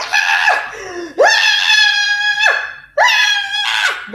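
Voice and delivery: high mock screams of labour pain, laughing; retold screams. Retelling the birth to viewers, high screams of pain. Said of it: "I'm hollering at the top of my".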